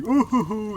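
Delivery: high, loud "ooh-hoo-hoo"; goofy falsetto hoots; silly clown hooting. Acted. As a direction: whoop through a silly little dance. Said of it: "do his silly little dance".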